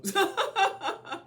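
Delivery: loud high-pitched laugh; high burst of laughter. Real, not acted. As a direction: laugh out at yourself. Laughs at own slip.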